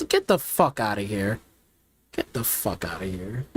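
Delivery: low, dismissive drop in pitch; real low-voiced contempt. Real, not acted. Drops the mock voice, dismissive.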